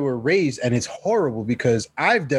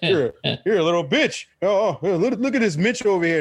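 mocking scoffer; 5 semitones higher than usual. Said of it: "why do you gotta look at me like".

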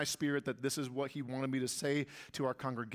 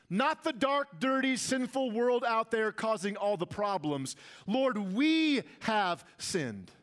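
high-pitched, emphatic sermon delivery; impassioned preaching; 8 semitones higher, 4 dB louder than usual. Real, not acted. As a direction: preach it hard, landing on each key word. Preaching at full force.

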